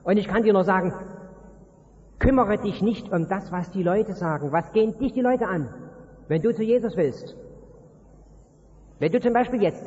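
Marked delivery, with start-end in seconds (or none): none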